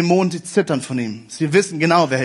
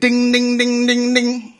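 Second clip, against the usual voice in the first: sing-song bell chime, held high and even; playful imitation of a ringing bell; mock bell ringing; 4 semitones higher, slower than usual. Imitating end-time bells ringing.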